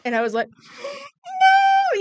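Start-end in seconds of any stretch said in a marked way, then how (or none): 0.7-1.2 put-on sharp high gasp of a frightened child; child gasping at the store manager's warning; high shocked gasp. 1.2-2.0 put-on loud high child's no; little girl crying out to the manager, high and loud; high frightened cry. Terrified child answering manager.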